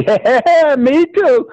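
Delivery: high, laughing yes; giddy high-pitched self-recognition; gleeful agreement. Acted. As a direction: jump up high in delight. Chris excitedly matches himself to idols.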